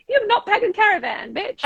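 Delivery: playful jab imitating her husband scolding her; mock annoyed husband. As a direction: play the grumpy husband for laughs. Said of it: "my hubby's gonna be home going".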